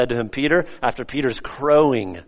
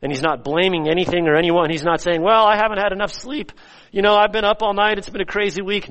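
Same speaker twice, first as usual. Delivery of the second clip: raised, higher preaching voice; emphatic preaching; 8 semitones higher, 5 dB louder, faster than usual. Real, not acted. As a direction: preach against the excuses, voice climbing. Preaches, voicing excuses.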